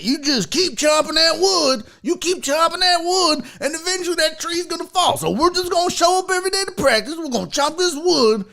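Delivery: put-on rally the boys with a folksy speech; earnest mock locker-room motivation; coach pep talk. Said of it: "some coaches are like".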